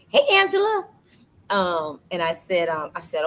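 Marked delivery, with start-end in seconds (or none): 0.1-0.9 high, louder sing-out hello; calling out a greeting. Real, not acted. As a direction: call out to a viewer, pitched way up. Greets an arriving viewer.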